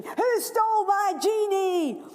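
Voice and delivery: put-on high, loud greedy child; demanding boy. Greedy boy demanding his present, high loud. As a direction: whine it like a spoilt boy.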